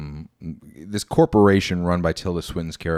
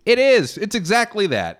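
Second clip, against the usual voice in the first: much higher, loud burst; sudden loud, high-pitched outburst; emphatic confirmation; 12 semitones higher, 4 dB louder than usual. Real, not acted. Exclaimed answer to own retold question.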